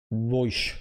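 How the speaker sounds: rich South Side Dublin "Roish"; posh South Dublin accent. Shown as a demonstration. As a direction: Dublin accent, "right" turned into "roysh". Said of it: "it's always, uh".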